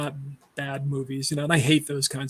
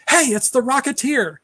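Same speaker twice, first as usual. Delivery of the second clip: higher-pitched club-goer's shout; mock excited yell; 9 semitones higher, 4 dB louder than usual. Nightclub patron spotting the hero as he shows up, mock excited yell. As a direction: call out like a thrilled onlooker. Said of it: "somebody yells that".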